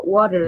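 water with a flapped t and r, worer; water as an American says it. Shown as a demonstration. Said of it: "dia akan sebut".

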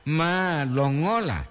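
"malongola" with a lengthened "ma"; long double A. Shown as a demonstration. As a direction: hold the first A of "malongola". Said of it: "Dua atau ma".

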